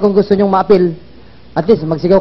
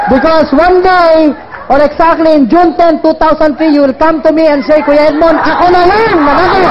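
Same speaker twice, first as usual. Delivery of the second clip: rousing proclamation; 8 semitones higher than usual. Real, not acted. Speaker predicting a future winner coming to him, rousing proclamation. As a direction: proclaim it excitedly over the crowd.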